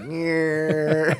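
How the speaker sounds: high, drawn-out imitated saw whine; wet saw noise. Telling how the contractor runs a wet saw, imitated saw noise. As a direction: mimic a whining tile saw. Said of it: "With a wet saw".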